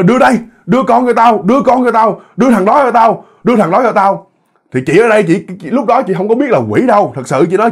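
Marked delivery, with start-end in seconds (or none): 0.0-4.3 high-pitched, repeated grabbing demand; insistent demand. Acted. Demon tugging the pregnant woman's arm for her baby, insistent demand. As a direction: demand it urgently, pitch raised.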